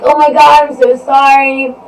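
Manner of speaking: imitating him gasping out an apology; startled apology. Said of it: "he was like".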